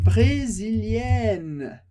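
"brésilienne" very slow, the -enne ending sounded clearly; feminine ending. Shown as a demonstration. Teacher contrasts with masculine form, very slow and clear. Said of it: "muda a pronúncia".

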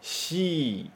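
"Shi" said as the Japanese syllable "shi", not English "si"; "Shi" with sh, like the Japanese letter name for C. Shown as a demonstration. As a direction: model "Shi" with a soft sh sound for students to echo. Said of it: "repete depois de mim".

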